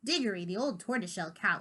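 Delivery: ordinary delivery, nothing marked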